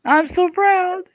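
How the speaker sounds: very high, drawn-out squeal; mock proud. Host reacts to guest's spiral blade attempt, mock-proud squeal. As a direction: gush it slowly in a tiny high voice.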